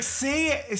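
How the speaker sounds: ordinary talk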